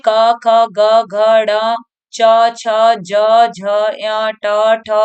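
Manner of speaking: क ख ग घ ङ च छ called out loud, one by one; loud classroom recitation of Hindi consonants; reciting letters loudly. Shown as a demonstration. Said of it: "reciting consonants aloud".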